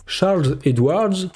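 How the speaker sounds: slow, clumsy French-accented English; bad English accent. Shown as a demonstration. Vlogger repeating an analyst's name in English, bad accent. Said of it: "avec un accent pourri en plus".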